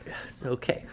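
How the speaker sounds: deflated whispered echo. Acted. Teacher echoing a student's lukewarm review of his poem, in a mock-hurt whisper. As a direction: whisper it back, mock-crestfallen.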